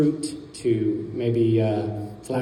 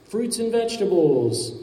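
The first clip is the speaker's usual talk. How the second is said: imitated vendor shout; 8 semitones higher than usual. Sellers going by alerting the street, mock shout. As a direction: call it out like a passing hawker. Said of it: "and shouting something like".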